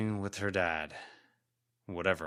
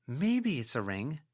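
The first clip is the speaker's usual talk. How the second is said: plain reading voice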